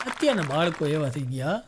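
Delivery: ordinary talk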